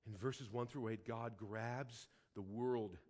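low, soft, reflective sermon voice; hushed, grave preaching. Acted. Preacher recalling the scare with his son, hushed and sober. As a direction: bring it right down, nearly to yourself.

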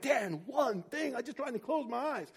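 high, held-in mock anger; retold exasperation. Driver scolds Dan over running out of gas, exasperated. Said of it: "I was so angry".

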